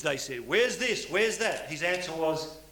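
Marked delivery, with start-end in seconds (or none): 0.5-1.7 loud and clear. Retold or imitated. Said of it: "he said loud and clear".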